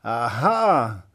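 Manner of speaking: loud jeering "Aha"; scornful. Acted. The witch mocking the prince as he climbs in, a loud scornful cry. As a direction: crow it in mock triumph. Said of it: "rief sie höhnisch".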